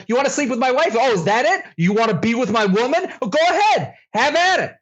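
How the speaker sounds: over-the-top indignant dad; mock jealous rant. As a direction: dare them in hammed-up outrage. Acts out absurd jealous rant at son.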